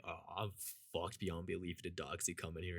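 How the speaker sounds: low, very soft inner voice; dread under the breath. Retold or imitated. Student thinking about his pen and the dogs, hushed. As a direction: sink into a hushed, resigned aside.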